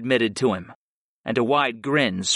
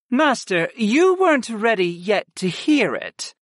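high-pitched, lightly voiced goddess; murmuring goddess; 9 semitones higher than usual. A narrator's reading. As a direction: murmur it to your master in a raised voice. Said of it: "Sarah murmured".

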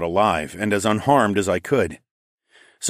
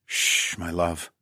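soft hush, softer and lower; soothing; 4 semitones lower, 5 dB quieter, much slower than usual. A narrator's reading. Comforting his crying love, softly soothing. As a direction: hush her gently. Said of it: "I soothed".

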